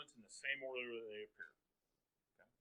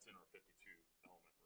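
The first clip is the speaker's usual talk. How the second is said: fast unvoiced whisper; a quick whispered aside to the class; 5 semitones lower, 27 dB quieter, faster than usual. Real, not acted. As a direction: toss it off under your breath, quickly. Aside after naming tomorrow's elements.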